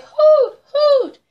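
high, loud falsetto hooting; bright sung owl calls, very high; owl hoots. Acted. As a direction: hoot out in pairs like an owl. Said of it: "Turn your head and say".

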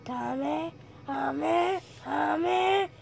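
building chant into a scream; charging a Kamehameha. Pretend. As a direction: power up and scream it out. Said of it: "Do Hami Kami".

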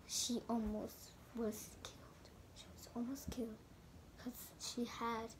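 hushed dramatic whisper; breathy storyteller's hush. A narrator's reading. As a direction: whisper it like a secret.

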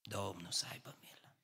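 whispered under the breath, very soft; mock muttered piety. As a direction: mutter it sanctimoniously as a silent grumbler. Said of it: "dar faci așa". (șoptit ironic, foarte încet)

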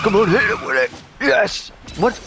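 excited cheer. Real, not acted. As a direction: egg the character on, then celebrate. Urging hit then cheering.

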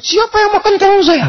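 high, shrill-pitched mock shriek from the wife; woman's shout. The wife finds her eggplant eaten and shrieks in a high voice. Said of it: "dia teriak".

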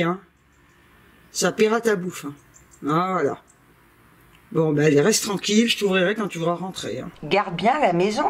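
ordinary delivery

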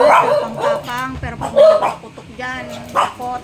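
mock the brave dog; loud mocking tease; teasing dog. Real, not acted.

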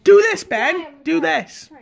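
high-pitched, insistent instruction; urgent prompting. Real, not acted. Urging Ben to copy an action.

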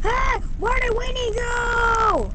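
high, slow calling out; worried shout. Pretend. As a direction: call out for the lost toy. Shouting for lost toy.

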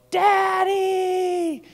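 put-on high, loud child calling Daddy; little girl's cry. Father imitating his daughter running to him, high and loud. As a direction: shout it like a toddler running in.

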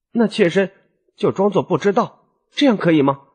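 high, lightly voiced timid wife; small-voiced wife. A narrator's reading. Trusting wife checks with her husband, small-voiced and high. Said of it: "小声地说".

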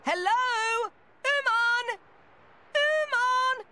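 loud, rising calls across the workshop; calling out. Acted. Tora calls out looking for Umon, loud and rising.

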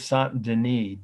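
Saint Denis as "sahn duh-NEE"; French pronunciation. Shown as a demonstration. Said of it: "that's the French pronunciation".

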